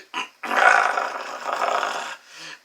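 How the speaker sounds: long, loud, rough throaty groan; loud guttural groan. Acted. Man overwhelmed on waking up, loud guttural groan. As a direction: let out a drawn-out acted groan. Acted reaction to waking surprise.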